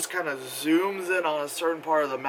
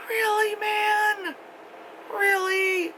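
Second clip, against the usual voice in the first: high, drawn-out mock disbelief; incredulous really; much slower than usual. Real, not acted. To the screen, scoffing at the plot in disbelief. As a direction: stretch it out, unimpressed.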